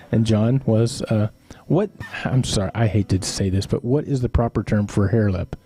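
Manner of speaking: ordinary talk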